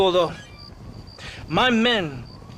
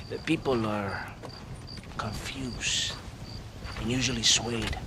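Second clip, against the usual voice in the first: whisper; 7 semitones lower, 8 dB quieter than usual. Acted. Official whispering to the gunslinger about his people. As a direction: drop to a bare whisper on one word. Official confides grim politics.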